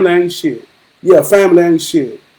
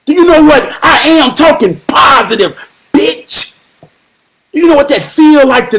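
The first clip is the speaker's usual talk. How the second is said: preaching shout; 6 semitones higher than usual. Real, not acted. Preaching to his viewers about words as spells, shouting and building. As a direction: shout it to the congregation, rising.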